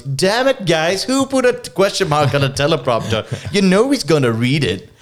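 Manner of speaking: high, hammed-up anchorman complaint; mock angry newsreader tirade; mock exasperated outburst. Acts out angry newsman's complaint.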